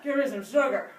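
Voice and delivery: weaker plaque demand. Acted. Weakened plaque asking for sugar, milder demand. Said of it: "the plaque would say".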